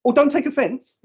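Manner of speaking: high, bright mock reassurance; client's breezy reassurance. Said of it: "until she went".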